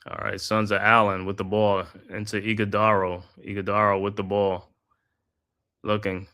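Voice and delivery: steady broadcaster's call of the action; live basketball play-by-play commentary. Acted. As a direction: call the game like a courtside announcer. Calls the game play by play.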